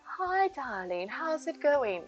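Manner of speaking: soft, slow snooty lady voice; a light, hushed snooty drawl. Retold or imitated. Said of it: "Oh, probably not like that".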